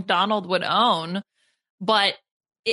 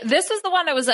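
usual speaking voice

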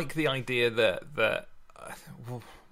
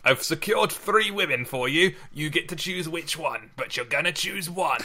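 higher put-on bossy voice; mock pushy matchmaker; 6 semitones higher than usual. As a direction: hand over the brides like a pushy uncle.

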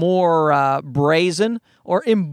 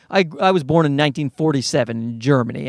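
ordinary talk, nothing marked

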